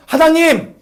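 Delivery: high, loud crying-out to God; Elijah praying. Acted. Elijah under the tree crying out to God, anguished. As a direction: call up to heaven in anguish. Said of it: "엘리야가 말하는 거죠".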